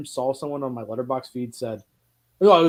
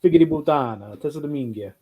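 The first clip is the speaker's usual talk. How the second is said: Italian cussing. Shown as a demonstration.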